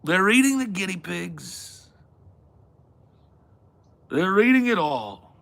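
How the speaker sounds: building absurd list in a mock Trump voice; Trump impression. Parody of Trump debate line.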